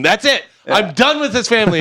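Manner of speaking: high, exasperated outburst; mock fed up. Imitating sitcom son quitting his crazy family, mock exasperation. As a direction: act out storming off, had enough.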